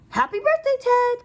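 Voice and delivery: high, loud happy birthday call. A narrator's reading. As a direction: call it across the house, bright and high. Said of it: "called his mom".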